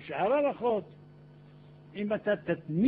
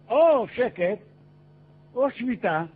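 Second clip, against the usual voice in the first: loud, higher-pitched burst of discovery; surprised exclamation; 5 semitones higher, 8 dB louder, slower than usual. Acted. Traveler exclaims at sudden silence in hell.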